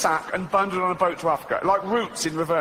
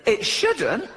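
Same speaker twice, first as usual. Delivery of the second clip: goat voice; 7 semitones higher than usual. Retold or imitated. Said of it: "He went".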